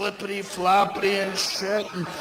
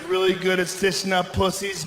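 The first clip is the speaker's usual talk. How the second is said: usual speaking voice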